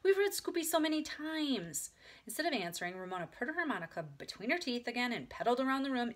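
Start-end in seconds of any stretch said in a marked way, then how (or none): 0.0-1.9 high, dragged-out complaint; complain, stretching the words; protesting. A narrator's reading. Big sister sick of the book, protesting. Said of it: "protested Beezus".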